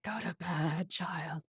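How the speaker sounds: low, drained, softly retold; half-hearted, tired mom telling a child off; weary bedtime plea. Exhausted mom retelling bedtime plea.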